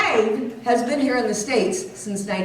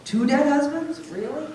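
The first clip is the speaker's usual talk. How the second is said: higher, questioning disbelief; pitched-up mock disbelief; incredulous audience reaction; 7 semitones higher than usual. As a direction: question it as if doubting the story. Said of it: "I know what you're thinking".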